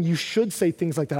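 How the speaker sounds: ordinary delivery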